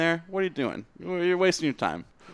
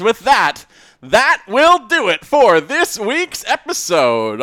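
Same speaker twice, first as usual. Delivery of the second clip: slow, loud, higher announcer voice; announcer sign-off; 8 semitones higher, 9 dB louder, slower than usual. Acted. Host wrapping up the episode, in a slow booming announcer voice. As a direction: boom out the show's close like an announcer. Host's big show sign-off.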